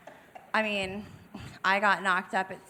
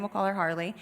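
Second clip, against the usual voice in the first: usual speaking voice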